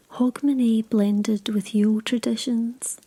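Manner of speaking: plain reading voice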